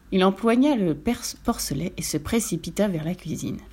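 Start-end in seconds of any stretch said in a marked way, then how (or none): none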